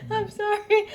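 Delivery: high, breathless apology through laughter; helpless giggling sorry; laughing. Real, not acted. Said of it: "Compose yourself".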